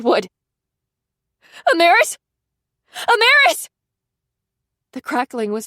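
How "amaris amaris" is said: high, loud repeated cries; desperate calling. A narrator's reading. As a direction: call her again and again. Pounding door calling.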